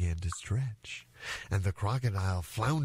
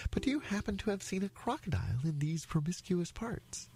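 high, polite little elephant; very polite child; 8 semitones higher, 3 dB quieter than usual. Acted. Elephant's child asks after a crocodile, high and polite. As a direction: ask innocently in a raised little voice. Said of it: "said the elephant's child most politely".

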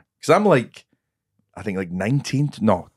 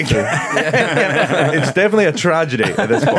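laughing; 5 semitones higher than usual. Real, not acted. Laughs at his own joke.

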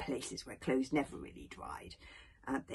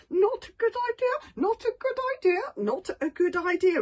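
much higher, louder anxious pleading; nervous repeating; 7 dB louder than usual. Acted. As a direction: panicky chant of warning. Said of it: "repeated Hiccup".

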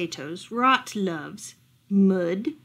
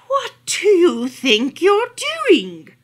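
loud, slow, high scolding voice; an old owl catching a villain in the act; stern old owl; 8 semitones higher, 10 dB louder, slower than usual. Acted. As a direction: call out the fox from the doorway. Owl confronts fox.

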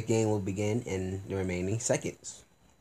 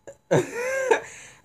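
high squealing laugh; falsetto laugh. Real, not acted. Laughs after losing.

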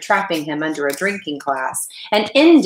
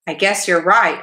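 ordinary delivery, nothing marked

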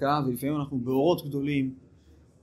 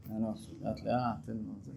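holding back; 4 semitones lower, 6 dB quieter than usual. Retold or imitated. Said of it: "משהו בך כזה בא לך".

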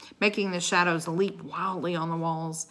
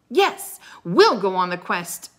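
yelled; 2 semitones higher, 10 dB louder than usual. A narrator's reading. Girl insists on the quest, yelled. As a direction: yell it with eager resolve. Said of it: "Annie yelled".

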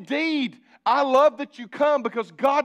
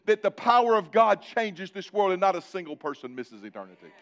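ordinary delivery

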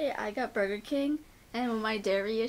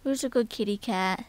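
normal talking voice

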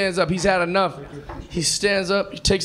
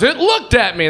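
louder, higher mock alarm; alarmed passenger; 5 semitones higher, 5 dB louder than usual. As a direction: blurt it out spooked. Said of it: "one guy goes".

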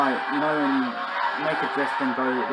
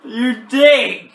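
loud furious insult; angry yell; 8 semitones higher, 9 dB louder, much slower than usual. Real, not acted. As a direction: shout the insult. Insulting the player who crashed.

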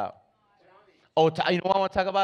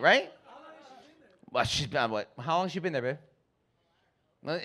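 ordinary talk

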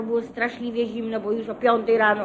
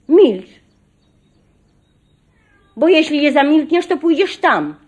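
put-on louder, sharp command as if to a child; stern, like to a child; 5 semitones higher, 7 dB louder than usual. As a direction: scold her like a naughty kid. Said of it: "jak do dziecka". (surowo, jak do dziecka, dużo głośniej)

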